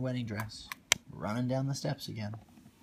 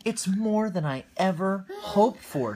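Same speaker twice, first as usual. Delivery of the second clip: higher, louder, thrilled declaration; declaring with delight; 10 semitones higher, 9 dB louder than usual. Acted. Cinderella at the fountain declaring her joy, higher and louder. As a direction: announce your dream came true. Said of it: "she declared".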